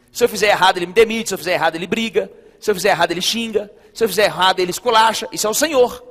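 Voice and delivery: loud, driving sermon build; forceful preaching. Real, not acted. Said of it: "preaching with force". (pregação forte e alta)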